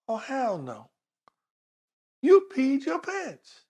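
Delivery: slow, high, incredulous; mock disbelief. Officer spotting the crying rider's wet pants, slow mock disbelief. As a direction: draw it out, pitch climbing in disbelief.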